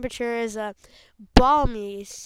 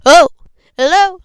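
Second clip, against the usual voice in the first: loud; 7 semitones higher, 15 dB louder, faster than usual. Real, not acted. Testing if the mic is on.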